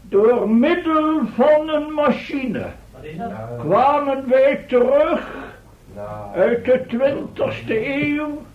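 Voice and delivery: calm the crowd from the podium; formal speech. Acted. Professor continues his address. (plechtige toespraak, soms vertraagd)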